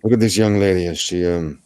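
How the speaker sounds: put-on vague mash-up of Russian, Spanish or German accents; made-up foreign accent. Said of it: "I don't even know what that accent is".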